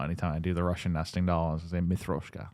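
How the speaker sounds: ordinary delivery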